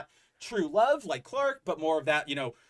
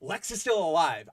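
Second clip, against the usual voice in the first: normal talking voice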